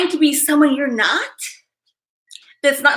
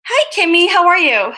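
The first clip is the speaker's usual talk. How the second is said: cheerful greeting; 4 semitones higher, 6 dB louder than usual. Real, not acted. Greeting viewer in chat.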